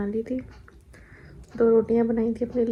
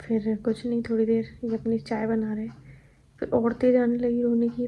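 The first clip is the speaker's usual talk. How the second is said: normal talking voice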